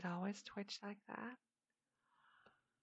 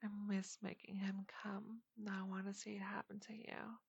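hushed breathy confession; whispering; 2 semitones higher than usual. Acted.

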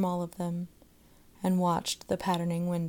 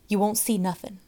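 savage; 2 semitones higher, 5 dB louder than usual. A narrator's reading. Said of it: "cried the boy savagely".

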